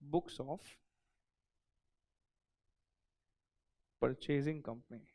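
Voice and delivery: each word drawn out for note-taking; unhurried dictation; slow dictation. Shown as a demonstration. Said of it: "heading डालना".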